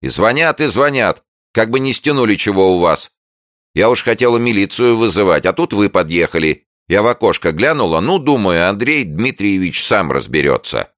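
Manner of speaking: high, loud, fussy complaint; tattling neighbour. A narrator's reading. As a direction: pour out grievances to the host. (суетливая соседка жалуется)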